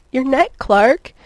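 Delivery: slow, loud, high cry; pleading wail, lightly acted. A narrator's reading. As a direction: draw the plea out insistently, voice swooping up. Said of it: "she cried as she fitted herself".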